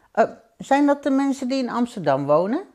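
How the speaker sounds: put-on high child's voice, questioning; asking little boy. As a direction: ask like a curious small boy. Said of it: "vroeg het jongetje". (vragend jongetje met hoge stem)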